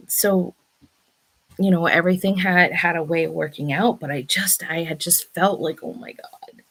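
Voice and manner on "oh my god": worried whisper. Retold or imitated. Retold worry.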